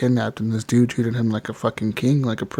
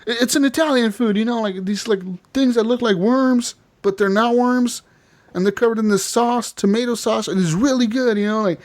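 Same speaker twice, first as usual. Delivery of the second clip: put-on higher kid voice, slowing on the worms; little Jack explaining; 9 semitones higher than usual. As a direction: earnestly describe spaghetti as a child. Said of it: "He's like".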